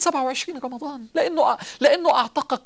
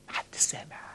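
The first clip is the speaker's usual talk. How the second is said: whisper; 12 dB quieter, slower than usual. Real, not acted. Whispered aside to congregation.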